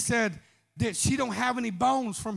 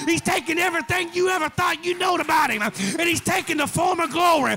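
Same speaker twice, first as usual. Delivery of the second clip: raised, rhythmic sermon cry; full-force preaching; 5 semitones higher, 5 dB louder than usual. Acted. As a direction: preach it hard over the music. Preaching climax.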